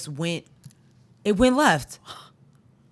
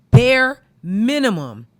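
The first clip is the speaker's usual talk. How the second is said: "bare minimum" drawn out, much louder and higher; 1 slow loud stress; 6 semitones higher, 11 dB louder, much slower than usual. Real, not acted. Ranting about school districts, loud slow stress. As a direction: hammer "bare minimum" slowly.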